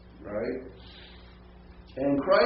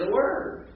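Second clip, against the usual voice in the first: "word" jumps much higher with a rise; stress on "word"; 12 semitones higher than usual. Shown as a demonstration. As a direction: lift "word" high to show the focus. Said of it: "that's the emphasis there".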